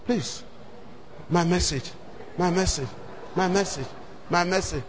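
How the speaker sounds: soft, low pleading repeat of my message; murmured panicked plea; quiet desperate prayer. Retold or imitated. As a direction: beg under your breath. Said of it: "within me I was praying".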